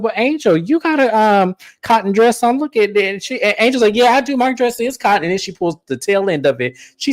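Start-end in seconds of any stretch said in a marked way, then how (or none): 0.0-3.0 high-pitched imitation of a shady remark; imitating Wendy. Wendy quizzing Angel about a cotton dress, mock innocent retelling. 4.0-5.2 high-pitched retold reply; imitating Angel. Angel answering Wendy about the dress, playful imitation.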